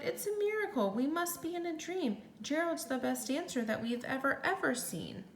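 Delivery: a crowd marvelling at a wonder; amazed shouting. Acted. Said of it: "They shouted".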